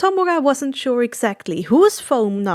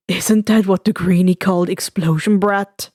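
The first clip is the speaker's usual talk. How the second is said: ordinary delivery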